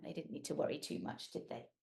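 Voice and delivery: soft, fast whisper; hushed aside. Acted. Storyteller comforting kids, soft whisper. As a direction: whisper it reassuringly.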